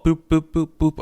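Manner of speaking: little "boop" pitter-patter sounds; high, evenly bouncing boops; imitated footsteps. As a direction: imitate tiny minion footfalls. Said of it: "hears the, like, the fall".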